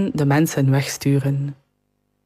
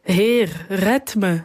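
slightly raised, restrained plea; a touch of alarm, higher in pitch; understated cry for help; 4 semitones higher, slower than usual. A narrator's reading. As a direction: cry out mildly, as a narrator would. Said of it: "schreeuwde hij".

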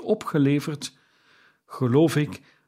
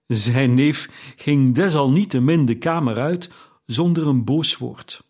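ordinary delivery

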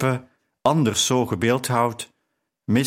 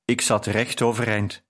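ordinary delivery, nothing marked

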